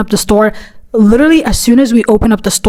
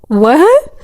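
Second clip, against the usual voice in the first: baffled; 5 semitones higher than usual. Retold or imitated. Acts baffled reply to scolding customer.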